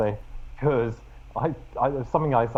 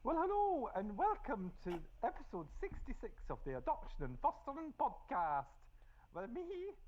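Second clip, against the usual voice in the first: high, soft hammed-up Scottish old lady voice; Scottish accent; 12 semitones higher, 14 dB quieter than usual. Doing the podcast intro as a Scottish granny, high and soft. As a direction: squeak it out like Supergran, high in the head. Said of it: "What accent is that?".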